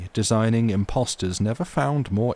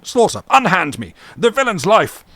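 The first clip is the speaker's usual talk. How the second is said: much higher and louder, straining to get free; furious outcry; 11 semitones higher, 10 dB louder than usual. Acted. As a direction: rage against being held back. Captain demanding the villain's life.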